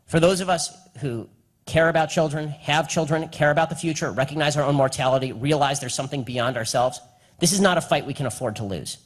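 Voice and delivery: usual speaking voice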